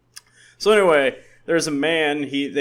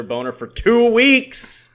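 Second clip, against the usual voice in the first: drawn out, higher and louder; excited punchline; 10 semitones higher, 5 dB louder, slower than usual. Pretend. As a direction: build it up to the punchline. Punchline escalation.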